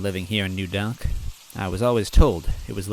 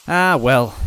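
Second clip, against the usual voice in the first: loud friendly bean man; 5 semitones higher, 9 dB louder than usual. Acted. Green man answers.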